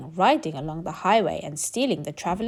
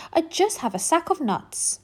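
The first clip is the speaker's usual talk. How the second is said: much higher small squirrel voice; timid squirrel; 8 semitones higher than usual. Acted. Squirrel answering the rat, high and nervous.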